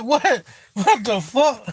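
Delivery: high retold shock breaking into laughter; amused disbelief. Streamer replays his shock at the troll, laughing. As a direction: act out disbelief, then crack up.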